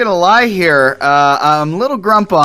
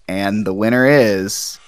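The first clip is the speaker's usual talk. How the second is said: slow, drawn-out winner reveal; suspenseful awards presenter; 4 semitones lower, slower than usual. Pretend. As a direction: stretch the name for suspense. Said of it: "That sound effect did not have the intended".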